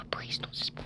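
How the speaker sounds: hushed whisper so mom won't hear. Real, not acted. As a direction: breathe it out in a whisper.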